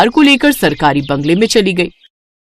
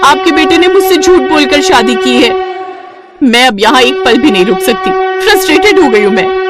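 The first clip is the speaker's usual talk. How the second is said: higher-pitched, exasperated wife; frustrated outburst; 6 semitones higher than usual. Acted. Padma complains that she was lied to into marriage, frustrated. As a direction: voice a fed-up wife's complaint.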